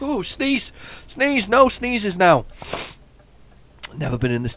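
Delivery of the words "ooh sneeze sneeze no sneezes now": playful squeal, much higher; squeaky high voice. Pretend. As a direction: squeak it out like a cartoon. Playful squeal about a sneeze.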